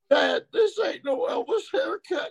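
put-on high, wailing child's protest; whiny crying kid. Little boy wailing at his dad about the haircut, high crying whine. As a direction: wail it like a let-down five-year-old.

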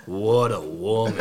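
lightly voiced Little Rascals kid impression; slow, drawn-out smitten line, mock impression; Alfalfa. Imagining a buddy as a Little Rascals character, lightly imitated. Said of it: "imagine Kevin turning into, like, Alfalfa".

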